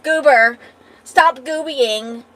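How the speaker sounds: loud pet scolding. Real, not acted. Talking to her pet.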